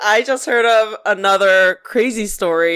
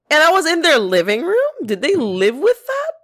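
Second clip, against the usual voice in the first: incredulous; 7 semitones higher than usual. Real, not acted. Shocked at the story.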